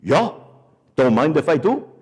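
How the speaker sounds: lightly put-on East German accent. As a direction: mimic a big East German wrestler. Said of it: "the guy talked like it".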